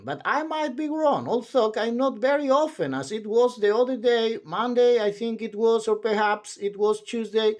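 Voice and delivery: high, babbling chatterbox voice; a high chatty voice that never stops; endless chatterer. Acted. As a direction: ramble on brightly without pausing for breath. Chattering character voice.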